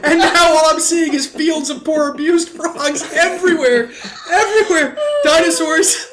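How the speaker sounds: high giggling through words; can't stop laughing. Real, not acted. Laughing fit about frog.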